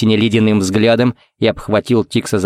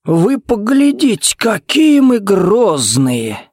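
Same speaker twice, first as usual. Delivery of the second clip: high, drawn-out mock awe; 11 semitones higher, slower than usual. A narrator's reading. Jared jeering at rival's threat, theatrically mocking. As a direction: stretch it out in an exaggerated high taunt.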